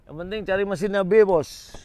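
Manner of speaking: loud, high shout to the viewers; loud call-out. Real, not acted. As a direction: call out the tip loudly.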